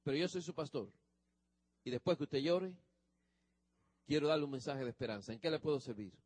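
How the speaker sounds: mild reassuring hush; gentle pastoral comfort. Retold or imitated. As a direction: soothe a weeping church sister. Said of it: "le digo a la hermana".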